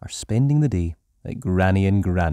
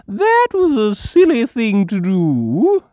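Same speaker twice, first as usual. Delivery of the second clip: high, louder scolding granny; Granny Pig voice; 12 semitones higher, 7 dB louder than usual. A narrator's reading. Granny Pig telling Grandpa he was silly. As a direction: tut at him fondly. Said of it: "says Granny Pig".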